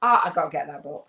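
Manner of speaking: loud, eager burst about the book; excited. Retold or imitated. On seeing the book on YouTube, a loud eager burst. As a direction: blurt it out like you must have it now. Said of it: "I was like".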